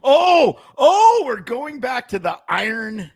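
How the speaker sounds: high, loud laughing outburst; real laughing yell, up high; laughing shout. Real, not acted.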